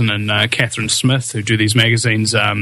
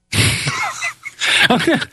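breathy falsetto laugh; high laugh. Real, not acted. Host laughing after his Shane impression. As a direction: laugh at your own bit.

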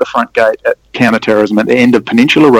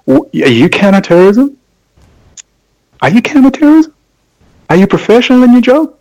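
much higher and louder, disbelieving; incredulous mocking questions; 10 semitones higher, 5 dB louder than usual. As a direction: fire the questions in mock disbelief. Mocking police competence, incredulous.

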